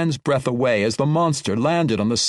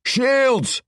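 a high battle yell; 11 semitones higher than usual. Acted. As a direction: yell the one word. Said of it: "he yelled".

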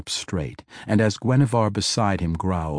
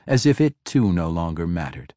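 plain reading voice

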